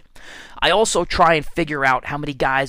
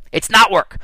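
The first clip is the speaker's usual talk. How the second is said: it's NOT work, not punched high and loud; stress on not; 6 semitones higher, 6 dB louder than usual. Real, not acted. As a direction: hit the word not hard. Insisting email is not work.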